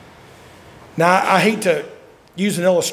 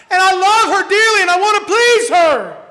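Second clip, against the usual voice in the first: high, loud preached cry; a ringing pulpit cry; preaching shout; 13 semitones higher, 7 dB louder than usual. Acted. Preached declaration, then echoed softly.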